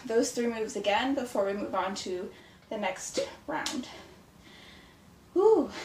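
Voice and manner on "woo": high excited woo. Real, not acted. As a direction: whoop high and loud. Cheer after a set.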